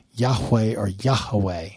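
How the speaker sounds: first Yahweh as YAH-weh, then Yahweh as YAH-ha-weh; two pronunciations. Shown as a demonstration. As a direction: contrast Yahweh in two and three syllables. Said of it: "a two-syllable or s-, three-syllable word".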